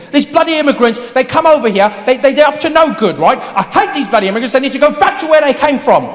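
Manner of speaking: high-pitched British tirade; British anti-immigrant ranter. Acted. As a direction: rant indignantly in a British accent. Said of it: "People saying the weirdest things".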